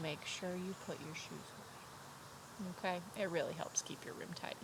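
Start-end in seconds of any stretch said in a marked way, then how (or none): 0.0-2.7 soft, slow, hushed reminder; hushed advice. Real, not acted. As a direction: confide the tip quietly.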